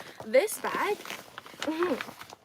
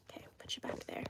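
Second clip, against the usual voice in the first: soft unvoiced whisper; 15 dB quieter, much faster than usual. Real, not acted. Whispering as she puts the pouch away. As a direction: whisper it gently like tucking something away.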